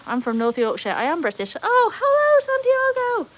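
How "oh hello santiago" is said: high, long drawn-out hello; delighted greeting. Real, not acted. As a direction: sing out the hello high and slow. Calling greeting to a chat member.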